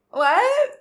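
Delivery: high, loud disbelieving yelp; a sudden stunned squeak; shocked. Retold or imitated. Hearing she is standing right outside her building, stunned.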